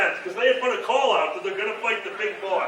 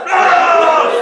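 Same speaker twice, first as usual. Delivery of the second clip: loud, high nonsense yell; hammed-up monster-fight yell; mock roar; 5 semitones higher, 11 dB louder than usual. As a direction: bellow a garbled battle noise. Acts out spell effect.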